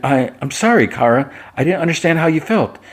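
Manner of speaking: high, lightly acted apology; pleading apology. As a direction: play a flustered friend saying sorry. Jimmy apologizes to Kara.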